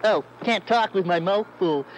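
ordinary delivery

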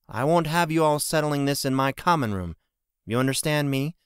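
a hint of a firm innkeeper warning him off; Miss Maris. A narrator's reading. Innkeeper warning Jensen away from her common room, sternly.

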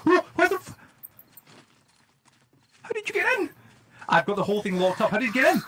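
very high, panicked disbelief; startled. Real, not acted. Player finds intruder inside locked base, startled. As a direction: jump out of your skin, voice shooting up.

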